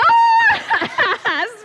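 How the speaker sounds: high, loud burst of laughter; shrieking laugh. Real, not acted. Child caught licking lips.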